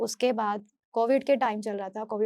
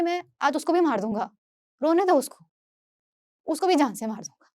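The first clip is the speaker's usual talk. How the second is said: louder, higher imitation of an angry husband; retold angry threat; 5 semitones higher, 5 dB louder than usual. Husband threatening wife over their crying child, retold angrily. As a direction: throw out the threat as he did, voice raised.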